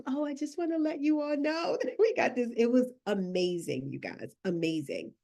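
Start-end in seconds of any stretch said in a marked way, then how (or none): 0.1-2.5 a touch of shy laughing; shy client quote. Retold or imitated. Client quoted to the audience, mock shy and giggly. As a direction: imitate her bashful, giggly reply. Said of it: "she was like".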